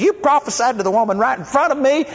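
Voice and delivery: imitating an upset woman who missed her prophecy; hammed-up whiny complaint. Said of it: "She was upset".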